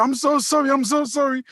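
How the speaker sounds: high-pitched repeated sorry; apologetic woman. Retold or imitated. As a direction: imitate a woman apologising profusely. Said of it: "the woman I'm talking to was quite apologetic".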